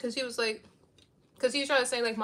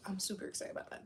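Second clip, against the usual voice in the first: soft whispered aside; 4 semitones lower, 11 dB quieter, faster than usual. Real, not acted. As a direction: whisper it to the viewers.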